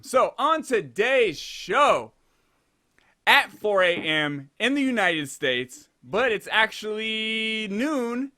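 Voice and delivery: slow, stretched-out announcer build; show announcer. Real, not acted. Host introducing today's show, slow announcer build. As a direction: draw out each phrase like a big reveal.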